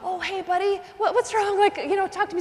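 high-pitched, kindly check-in; coaxing a child. Retold or imitated. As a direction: talk a crying little one round. Teacher soothing a sobbing boy.

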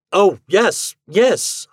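high, loud, hurried-off agreement; frantic agreeing. Acted. Husband hiding a ghost from his wife, frantically agreeable. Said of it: "I agreed".

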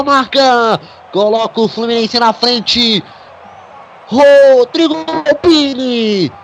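screaming goal call, stretched and high; explosive goal commentary. Real, not acted. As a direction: roar the goal, drawing out every word.

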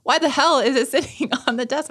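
high, loud indignant question; exasperated retelling. Retold or imitated. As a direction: re-enact an outraged scolding, half laughing. Retold scolding about the empty container.

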